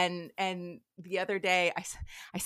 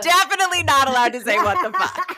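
louder, laughing punchline; laughing retort; 4 semitones higher, 9 dB louder than usual. Retold or imitated. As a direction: deliver it through a laugh. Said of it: "And I was like".

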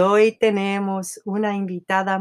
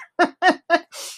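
laugh; 9 semitones higher than usual. Real, not acted. Laughing at forgetting the word.

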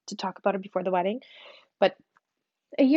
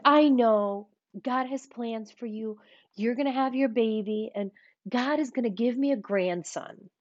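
put-on Southern accent. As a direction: draw it out Southern, like a believing mother. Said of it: "She doesn't have a Southern accent".

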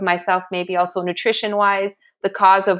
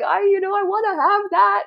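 pleading; 12 semitones higher than usual. Retold or imitated. Her plea to runners.

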